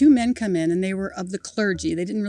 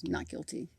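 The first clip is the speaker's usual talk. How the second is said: hushed verdict; 12 dB quieter than usual. Retold or imitated. Officer's quiet final verdict.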